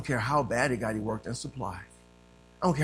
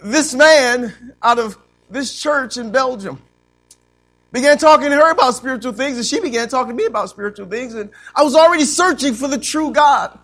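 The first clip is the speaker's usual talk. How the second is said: loud, raised preaching voice; forceful preaching; 10 semitones higher, 14 dB louder than usual. Real, not acted. Preacher telling church of his search for God, forceful preaching.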